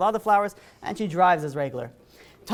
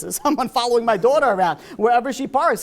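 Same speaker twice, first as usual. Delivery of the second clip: mock-agitated dad, louder and higher; retold parental alarm, voice raised; alarmed father; 5 semitones higher, 6 dB louder than usual. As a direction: act out a worried father's outcry. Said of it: "the father was never, they're gonna call the police".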